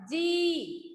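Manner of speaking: "जी" with the ee held long; long ee vowel. Shown as a demonstration. Teacher repeating the answer for the student, long-drawn "जी". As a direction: stretch out the vowel of "जी". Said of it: "लंबा हो जाता है".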